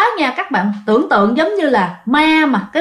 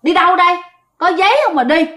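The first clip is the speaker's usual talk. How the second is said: stern gatekeeper; 6 semitones higher, faster than usual. Retold or imitated. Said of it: "chặn lại hỏi rằng á là".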